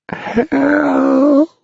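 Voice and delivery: very high, long drawn-out wail; sad wail. Acted. Pipkin wailing for help from below, high and drawn out. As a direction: cry for help from afar. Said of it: "wailed his sad voice".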